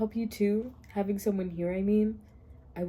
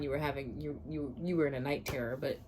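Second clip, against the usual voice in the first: quiet low mutter; 9 semitones lower, 9 dB quieter than usual. Real, not acted. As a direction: mumble it to yourself.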